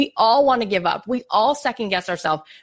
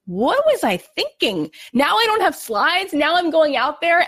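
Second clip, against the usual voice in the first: retold dread; 6 semitones higher than usual. The scared speaker, sick in bed before her talk, higher-pitched dread. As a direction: sound like the panic is only just sinking in.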